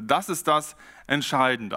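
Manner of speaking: ordinary talk, nothing marked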